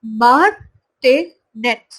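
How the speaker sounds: मार्टिनेट drawn out very slowly, syllable by syllable; slow pronunciation demo. Shown as a demonstration. Teacher models the word for students, very slow. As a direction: spell out मार्टिनेट slowly for the class. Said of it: "इसका pronunciation यहाँ पे दिया हुआ है".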